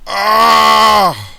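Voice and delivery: long, high, very loud scream; sustained loud "aah" of pain. A narrator's reading. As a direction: scream out in agony on one held note. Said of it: "Hoto screams in pain".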